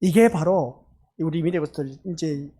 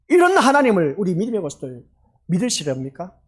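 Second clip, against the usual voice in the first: ordinary delivery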